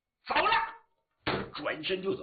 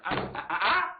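startled cry; 3 semitones higher, 4 dB louder than usual. Acted. Startled at the voice behind.

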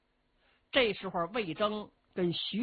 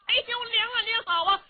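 high, loud, rough-and-ready hero greeting his mother; a boisterous, high-pitched bumpkin hero; Cheng Yaojin's voice; 6 dB louder than usual. Acted. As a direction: pitch it way up like a brash big-hearted fellow.

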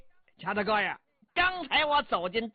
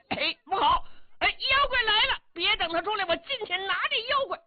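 higher, hurried cry that the monster is coming; excited alarm; 10 semitones higher, faster than usual. Acted. Yao Qi to himself, sensing the monster, hurried high alarm. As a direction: jump in with comic alarm, rushing the last words. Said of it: "姚琦心中明白".

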